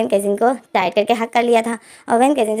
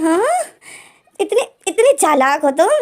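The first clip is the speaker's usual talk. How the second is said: shocked disbelief; 6 semitones higher, slower than usual. Acted. Shocked at his cunning.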